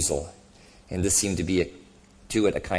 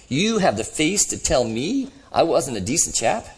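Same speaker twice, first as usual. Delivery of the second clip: higher-pitched, incredulous indignation; affronted disbelief; 8 semitones higher, 5 dB louder than usual. A narrator's reading. Ghost told he wasn't decent, affronted. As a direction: challenge it as a wounded insult. Ghost outraged at being judged.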